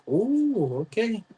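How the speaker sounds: high, excited client voice; mock-impressed Nigerian client gushing; light impression of an eager client. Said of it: "They'll be like".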